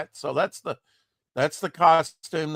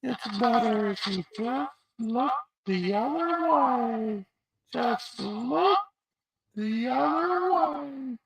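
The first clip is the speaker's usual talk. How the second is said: high slow altered voice; slow, high, electronic-sounding voice; voice changer; 8 semitones higher, 5 dB quieter, slower than usual. Pretend. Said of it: "You wanna try another one?".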